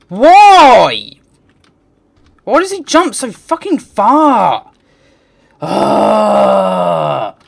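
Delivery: high, loud furious yelling; angry shout. Real, not acted. Gamer shouting at how far the character jumps. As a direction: scream the complaint at the game. Raging at jump distance.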